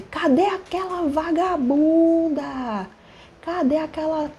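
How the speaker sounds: high, drawn-out mock yelling; imitated ranting. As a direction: play a man making a scene, high-pitched. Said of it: "chegou fazendo um escarcéu".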